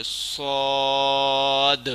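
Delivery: صاد thick, very slow, no whistle; heavy saad. Shown as a demonstration. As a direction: hold صاد heavy and long. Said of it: "موٹا پڑھنا".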